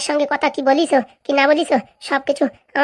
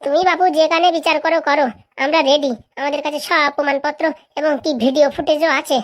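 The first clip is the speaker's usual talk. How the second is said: normal talking voice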